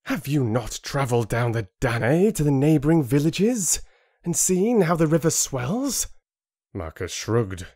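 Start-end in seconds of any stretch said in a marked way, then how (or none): 0.0-6.1 higher, pressing and accusing; pointed, rising accusation; accusatory tone. Acted. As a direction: demand an answer he knows is no. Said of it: "spoke in an accusatory tone".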